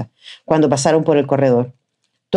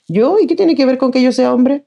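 much higher, offended disbelief; indignant protest; 9 semitones higher than usual. A narrator's reading. Boy objecting when told to go because he is a man. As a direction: push back, outraged.

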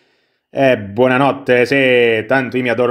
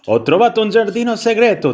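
high-pitched storybook cat voice; delighted cat; 9 semitones higher than usual. A narrator's reading. Cat tells the prince about the garden, high delighted voice. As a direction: voice a pleased cat sharing a secret. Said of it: "disse il gatto con molto piacere".

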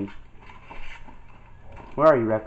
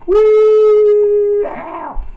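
much higher, louder whoop; wild cheer; 9 dB louder than usual. Retold or imitated. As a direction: whoop it like a hunter's victory cry. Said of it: "I'm just gonna be like".

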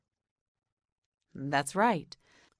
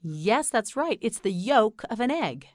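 normal talking voice

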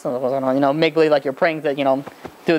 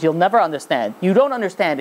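normal talking voice

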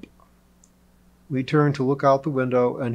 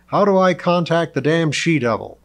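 loud, higher exasperated question; impatient demand; 5 semitones higher, 7 dB louder than usual. A narrator's reading. Lionheart loses patience.

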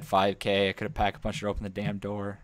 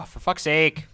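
frustrated; 7 semitones higher, 7 dB louder than usual. Real, not acted. Frustrated outburst.

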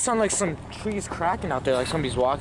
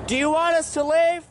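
high, loud calling question; talking to a ghost; 10 semitones higher, 4 dB louder than usual. Real, not acted. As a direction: ask the spirit loudly. Asking spirits.

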